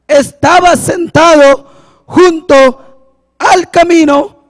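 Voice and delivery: slow, emphatic scripture reading from the pulpit; forceful measured preacher delivery; preaching. Real, not acted. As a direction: proclaim each phrase with pauses between.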